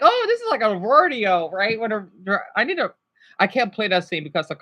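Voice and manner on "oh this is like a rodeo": imitating Sunny Cheeks. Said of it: "I like how she says".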